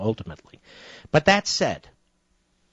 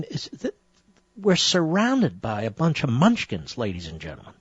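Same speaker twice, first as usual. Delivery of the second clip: ordinary talk, nothing marked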